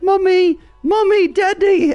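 put-on high squeaky child voice calling mommy; high squeaky kid calling for mommy and daddy; kids' voices. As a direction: imitate little kids with high squeaky voices. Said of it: "They were like".

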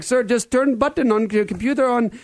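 put-on high Indian-accented scam caller; imitated Indian tech-support voice. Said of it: "with a strange Indian accent".

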